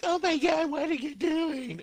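very high mock-girly shriek, drawn out at the end; shocked anime girls. Girls waking up to groping guy, mock shocked squeal. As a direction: squeal in hammed-up outrage. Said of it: "the girls wake up and they're like".

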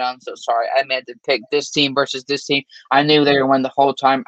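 usual speaking voice

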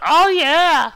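very high, loud mock whoop; over-the-top boyish hooting; pretend enthusiasm. Host imitating boys pretending to like girls, exaggerated whoop. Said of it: "like to pretend that we like girls".

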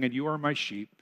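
a lightly voiced, gentle Jesus; reassuring reply. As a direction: answer like a caring shepherd. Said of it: "Jesus will respond by saying".

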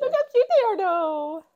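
high squeal of delight; cooing over cute animal. Real, not acted. Cohost showing a lynx photo, cooing excitedly. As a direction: gush over how cute it is.

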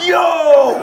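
a high, loud yelp to stop everyone; startled shout. Retold or imitated. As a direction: blurt it out high and loud, half laughing. Stopping the crying class.

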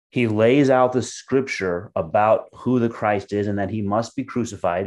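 ordinary delivery

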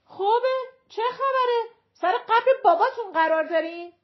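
high, loud sarcastic yelling; angry shout. A narrator's reading. Mother yelling at grumbling male passengers, angry shout. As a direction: snap back loudly at the grumblers. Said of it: "مادر بچه داد زد".

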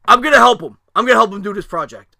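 loud, high announcement over the din; retold yelling. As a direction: re-enact a dad shouting that he's taking over. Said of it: "Everybody's yelling".

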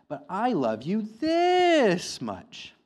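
slow, drawn-out, loud and high, topping his son; big father hare. Acted. Father hare outdoing his son at bedtime, slow and emphatic. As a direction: stretch every word as if the arms open wide.

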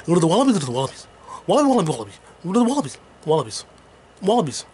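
fast chanted wallaby; light Bay Area rapper chant; [name] impression. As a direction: bounce the word wallaby fast like [name]. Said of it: "E forty talk and rap".

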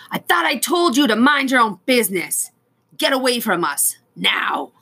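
high, loud angry yell. Acted. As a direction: shout him off the boat. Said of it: "he yelled".

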